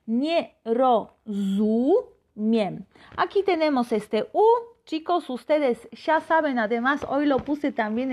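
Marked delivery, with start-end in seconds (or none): 0.1-2.0 "Nie rozumiem" very slowly, each syllable apart; slow Polish demo. Shown as a demonstration. Teacher modelling the phrase slowly after a student writes it. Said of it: "Katrina ya escribió muy bien".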